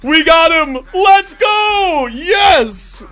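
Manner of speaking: real high-pitched celebration yell; ecstatic, loud victory cries; triumphant shouting. Real, not acted. Streamer celebrates winning the pull.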